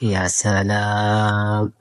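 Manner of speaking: soft drawn-out wow. Real, not acted. Mother peeking into her daughter's drawer, soft slow admiration. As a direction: breathe out the words slowly, impressed.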